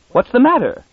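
high, sharp shriek of panic. A narrator's reading. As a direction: shriek it out in sudden alarm. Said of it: "shrieked Munn".

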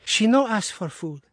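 high, indignant, broken English; a protective maid scolding her master; indignant foreign maid. A narrator's reading. Dolores tells her master the mistress is ill, indignant. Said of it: "with indignant eyes".

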